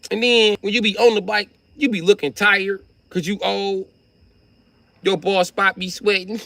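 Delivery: put-on high, loud teasing of an old man; mocking taunt. Taunting the bike thief about his age, mock high roast. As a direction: roast him for being old and bald.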